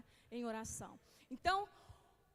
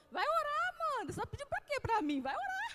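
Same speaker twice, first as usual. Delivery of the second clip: high, loud mock scolding of a daughter; firm mom command; 12 semitones higher, 7 dB louder, faster than usual. Mother tells her daughter Amanda to go pray, loud and insistent. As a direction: send the child off to pray, insistent. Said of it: "Eu falo assim com a Amanda".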